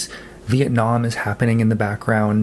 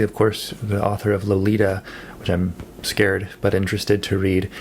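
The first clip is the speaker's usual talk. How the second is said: ordinary talk, nothing marked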